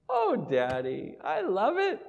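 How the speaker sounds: put-on slow, very high child's voice; little girl's voice. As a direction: stretch it out like a sweet little girl.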